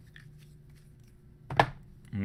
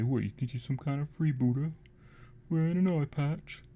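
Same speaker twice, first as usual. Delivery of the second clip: lightly voiced orc taunting a mate, a bit higher; mocking orc; 5 semitones higher than usual. Orc sneering at the one-eyed orc: light mock taunt. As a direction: jeer like a scrappy orc, half-hearted. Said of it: "make fun of him and say".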